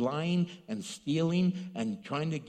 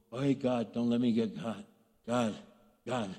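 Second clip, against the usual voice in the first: low-pitched pretend plea to God; mock furtive prayer; 7 semitones lower than usual. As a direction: lightly act a sneaky low prayer. Voices a thief's furtive prayer.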